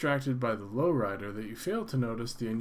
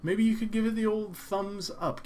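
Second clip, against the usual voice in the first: playful ask; 9 semitones higher, 3 dB louder than usual. Real, not acted.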